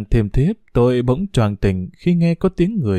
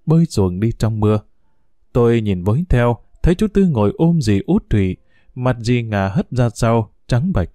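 ordinary delivery

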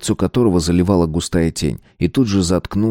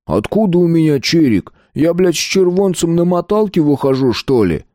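high-pitched aggrieved whine; whiny, tearful; 10 semitones higher than usual. A narrator's reading. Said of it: "запыхтел и плаксиво сообщил".

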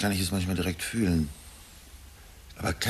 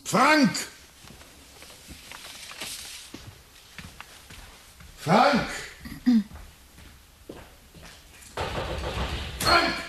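calling out; much slower than usual. Acted. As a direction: shout the name up the stairs. Calling son through the house.

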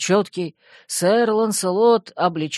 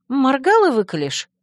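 quick, higher teasing cut-in; teasing interjection; 9 semitones higher, 5 dB louder than usual. A narrator's reading. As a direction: finish his threat for him teasingly. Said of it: "вставила я".

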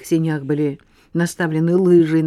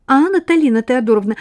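rattling off; 8 semitones higher, 7 dB louder than usual. Acted. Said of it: "затараторила Лена".

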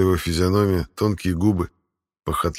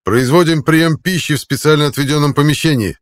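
barked formal report, raised pitch; loud military report; 9 semitones higher, 7 dB louder than usual. A narrator's reading. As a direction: report it loudly at attention. Soldier reporting to commander.

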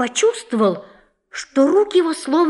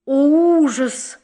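groaning despair; 4 semitones higher, slower than usual. A narrator's reading. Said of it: "застонал суперлятив".

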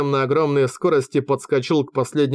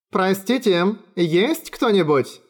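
higher-pitched, drawn-out call, no real shout; mild calling out; 6 semitones higher, slower than usual. A narrator's reading. Люсье calls to whoever is home, a mild, high call. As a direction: call lightly into a silent house. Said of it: "крикнула Люсье".

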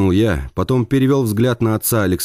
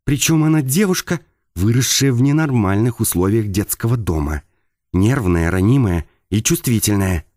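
higher, hushed voice with held-back anger; restrained anger; 5 semitones higher than usual. Acted. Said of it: "вибрировал сдерживаемый гнев".

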